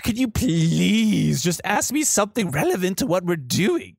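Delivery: high-pitched, pleading guide voice; exasperated tour guide. Acted. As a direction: play a fed-up guide begging for relevant questions.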